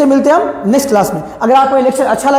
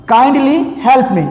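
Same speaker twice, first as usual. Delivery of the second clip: slow request; much slower than usual. Shown as a demonstration. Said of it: "क्या बोलेंगे जी".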